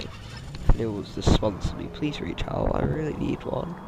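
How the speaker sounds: breathy half-whisper plea; whispered aside. Real, not acted. Half-joking aside begging for sponsor.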